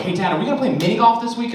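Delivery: put-on high eager child asking dad; kid voice. Kid asking dad about golf, high eager voice. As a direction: play the hopeful little kid.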